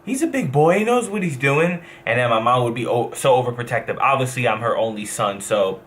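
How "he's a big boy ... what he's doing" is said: much higher, breezy carefree dad voice; imitating his dad. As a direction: toss it off like a dad who doesn't care. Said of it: "He'd be like".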